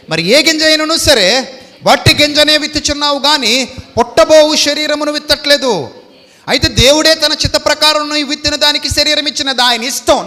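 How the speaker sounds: preacher lifting the Bible words at full voice; fervent preaching. Acted. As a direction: thunder the verse out to the congregation. Scripture at full force.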